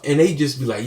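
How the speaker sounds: ordinary talk, nothing marked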